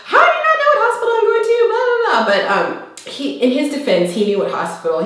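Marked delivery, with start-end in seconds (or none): 0.0-2.2 high, loud, rapid rant; hammed-up shrieky outburst, pitched way up; mock freak-out. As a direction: fire it off in exaggerated exasperated panic. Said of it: "I just freaked out".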